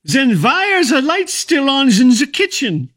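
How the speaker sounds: put-on a dying old man jolting upright to shout; sudden shout. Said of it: "sat upright and shouted".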